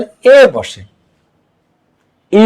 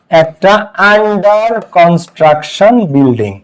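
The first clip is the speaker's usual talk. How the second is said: under construction building said word by word, very slowly; very slow model phrase; much slower than usual. Shown as a demonstration. Bangla ক্লাসে ছাত্রদের জন্য under construction building খুব ধীরে, ভেঙে ভেঙে বলা. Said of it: "এখানে আ হইছে".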